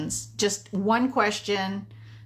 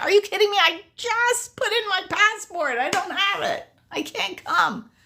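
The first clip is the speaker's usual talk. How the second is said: stunned disbelief; 10 semitones higher than usual. Retold or imitated. Telling her friend she has no passport, high stunned then deflating. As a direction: retell the shock, then deflate at can't come. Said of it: "I'm like".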